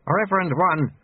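put-on old master supplying the missing title; prompting sweeper. A narrator's reading. Lutze prompts the proper title.

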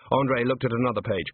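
ordinary delivery, nothing marked

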